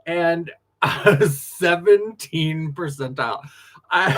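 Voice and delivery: slow, giggling through the number; can't stop laughing at the score; laughing through words. Real, not acted. Laughing while telling friends about a bad test score.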